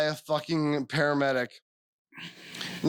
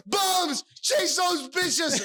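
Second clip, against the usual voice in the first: rally the bums with a yell; commanding shout; 10 semitones higher, much slower than usual. Retold or imitated. Ordering the bums to chase.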